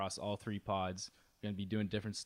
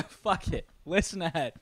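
high squeal through a laugh; 8 semitones higher, 6 dB louder than usual. Real, not acted. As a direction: burst out laughing on it.

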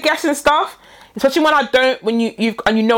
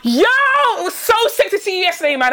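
higher-pitched fake enthusiasm; over-excited chumminess; 6 semitones higher than usual. Prank voice note to a near stranger, over-excited. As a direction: gush as if catching up with a close mate. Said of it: "I'm gonna be so excited".

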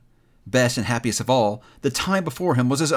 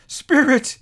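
high, loud call; desperate cry; 14 semitones higher, 7 dB louder than usual. A narrator's reading. Scrooge appealing to the silent spirit, desperate cry. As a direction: cry out imploringly. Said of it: "he cried".

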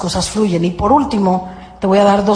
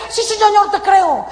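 higher, overeager yes; mock eager believer; 9 semitones higher than usual. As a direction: hurry to reassure God. Believer answering God.

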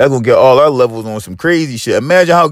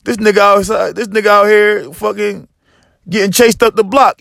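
normal talking voice

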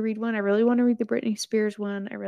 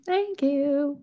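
higher, proud thank you; 5 semitones higher than usual. Real, not acted. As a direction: sing it out a bit higher.

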